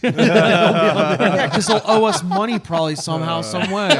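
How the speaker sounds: loud, laughing joke. Real, not acted. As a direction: laugh your way through it.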